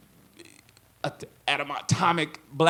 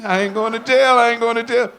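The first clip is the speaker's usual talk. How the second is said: much higher and louder, laughing; mock relief; 10 semitones higher, 11 dB louder than usual. Performer joking about dodging jail, loud high mock cheer. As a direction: shout it out in giddy relief.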